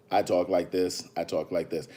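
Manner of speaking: very low, soft put-on voice; low, hushed mock impression; imitating a low talker. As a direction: drop right down to mimic her. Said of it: "she talks really low like this".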